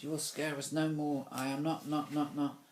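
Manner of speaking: stage declamation. A narrator's reading. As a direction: declaim bravely, then stick on a word. Declaiming, sticking on the line.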